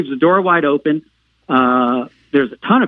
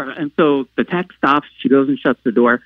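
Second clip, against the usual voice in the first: ordinary talk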